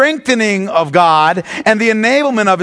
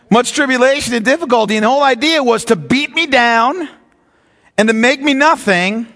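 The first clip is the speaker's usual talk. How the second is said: ordinary talk, nothing marked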